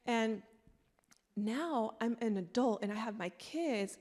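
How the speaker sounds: ordinary talk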